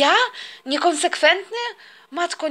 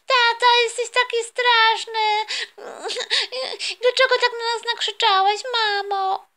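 put-on much higher, slow, whimpering little girl; tearful child; 7 semitones higher than usual. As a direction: cry it out like a hurt kid. Crying daughter accuses dad.

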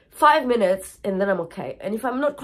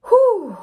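sigh; 12 semitones higher, 8 dB louder than usual. Real, not acted. Sighs heavily mid grief talk.

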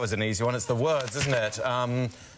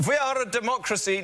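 higher-pitched Ukrainian accent; 6 semitones higher than usual. Shown as a demonstration.

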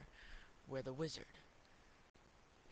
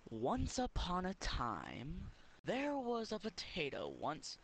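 storybook opening; 5 semitones higher, 4 dB louder, slower than usual. A narrator's reading. Fairy tale opening, drawn out.

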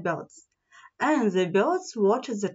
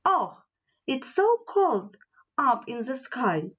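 ordinary delivery

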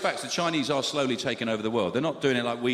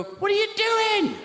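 very high, loud, slow mock alarm; hammed-up high alarmed question; shocked outcry; 5 dB louder, slower than usual. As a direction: cry out high and drawn out. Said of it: "people go".

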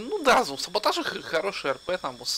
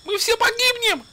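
shrieking high in panic; panicked scream; 7 dB louder, much slower than usual. Acted. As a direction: scream like the plane is crashing. Panicked passenger at takeoff.

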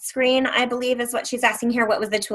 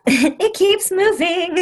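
laughing; 11 semitones higher, 5 dB louder, slower than usual. Real, not acted. Laughing at a moving screen.

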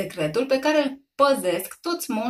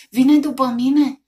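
scared; 4 semitones higher, 8 dB louder, slower than usual. A narrator's reading. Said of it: "a făcut Jojo speriat".

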